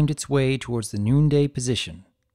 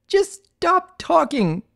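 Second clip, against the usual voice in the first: much higher, louder, slow shriek; 4 dB louder, slower than usual. Acted. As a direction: scream it in panic. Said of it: "Twilight shrieked".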